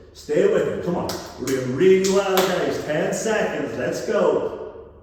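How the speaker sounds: shouting encouragement. Real, not acted. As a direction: push them hard through the last seconds. Coach pushes class in final seconds.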